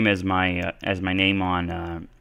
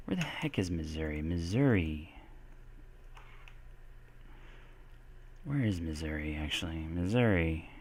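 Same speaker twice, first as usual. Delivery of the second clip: very soft, lower-pitched pondering; musing to oneself; 4 semitones lower, 14 dB quieter than usual. Real, not acted. Teacher puzzling over where Missouri is, musing quietly. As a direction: think aloud under your breath. Wondering to self, looking it up.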